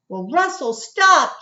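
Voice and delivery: mild storybook shout, stop pushed up high. A narrator's reading. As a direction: yell at him to quit. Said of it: "Jamaica shouted".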